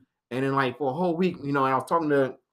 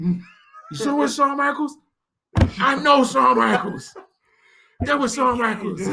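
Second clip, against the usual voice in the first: excited; 14 semitones higher, slower than usual. Retold or imitated. Starstruck back and forth.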